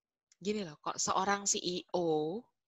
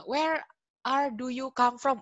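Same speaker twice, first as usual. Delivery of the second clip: higher, louder mock learner, word by word; learner's wrong English; 8 semitones higher, 7 dB louder than usual. Shown as a demonstration. Teacher demonstrates a bad-grammar question, high and loud mock learner. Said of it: "Gimana sih bahasa Inggris yang salah?".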